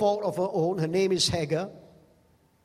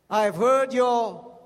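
slow, louder, solemn divine voice; God speaking gently; 2 semitones higher, 5 dB louder, slower than usual. Acted. Preacher voicing God to Hezekiah, slow and solemn. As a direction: say it like God consoling a dying man.

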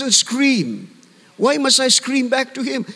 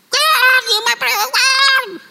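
mock high-pitched scream to God; screamed prayer; slower than usual. Preacher mimicking believers who scream at God, mock screaming. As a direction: scream out a prayer in a piercing, far higher voice. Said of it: "it's also not a scream".

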